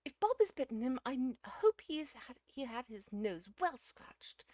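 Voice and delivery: high, loud, indignant lady; vindictive. A narrator's reading. Missus Uckridge turns on the dog Bob, vindictive. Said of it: "said Missus Uckridge vindictively".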